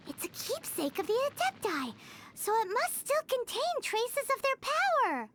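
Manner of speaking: normal talking voice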